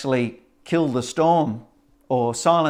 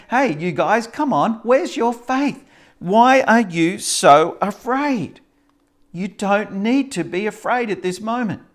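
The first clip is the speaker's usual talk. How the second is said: rebuking Jesus; 8 semitones higher, 4 dB louder than usual. Acted. Jesus voiced rebuking disciples.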